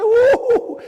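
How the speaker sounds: high, loud whoop with a laugh; laughing whoo. Retold or imitated. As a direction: whoop as if you can't believe the nerve. Retold exclamation at son's lie.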